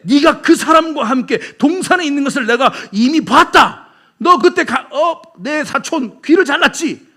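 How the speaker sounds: loud, high, hammering confrontation; forceful accusation. Acted. Relative of the wounded man confronting Peter, accusing. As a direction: raise the voice and nail them with evidence.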